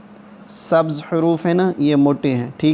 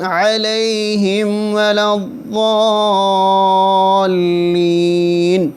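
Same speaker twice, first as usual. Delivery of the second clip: slightly raised, steady chanting of the verses; Quranic recitation; 5 semitones higher, much slower than usual. Shown as a demonstration. Teacher reciting Fatiha line by line for students, chanting. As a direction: recite each phrase slowly and repeat it.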